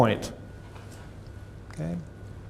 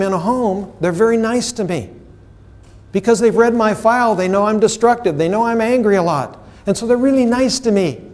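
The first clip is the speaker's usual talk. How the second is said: lightly voiced child, pitched up; child's account; 6 semitones higher than usual. Foster child explains her pattern to the therapist, lightly voiced child. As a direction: explain it a bit higher, as the child. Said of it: "And she said".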